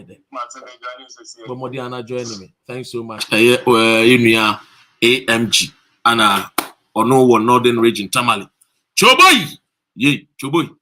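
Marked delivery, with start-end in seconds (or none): 3.3-8.5 booming, much louder hype call; loud, rousing shout-out to viewers. Real, not acted. 9.0-10.7 high, very loud cry of the name; excited shout. Real, not acted.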